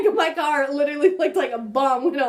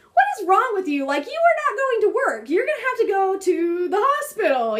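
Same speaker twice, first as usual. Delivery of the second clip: scolding myself; 7 semitones higher than usual. Retold or imitated. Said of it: "I'm thinking to myself".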